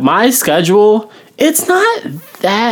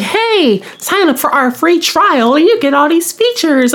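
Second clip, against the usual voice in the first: chirpy sales pitch; 10 semitones higher than usual. Retold or imitated. Mimicking app's sales pitch.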